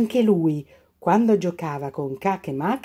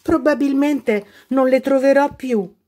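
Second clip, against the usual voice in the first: despairing; 7 semitones higher, 4 dB louder than usual. A narrator's reading. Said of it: "si disperava Cache Mache".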